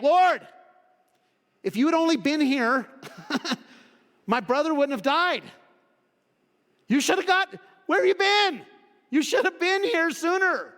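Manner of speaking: high, loud, aching reproach; disappointed. Retold or imitated. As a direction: cry out your hurt at the friend who came too late. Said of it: "you can hear the disappointment in her voice".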